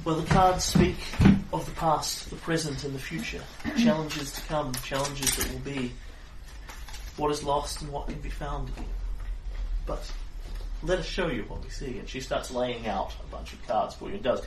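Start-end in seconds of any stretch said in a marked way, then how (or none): 0.0-12.0 raised, solemn mystic voice; fortune teller. Acted. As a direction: intone the cards' meaning.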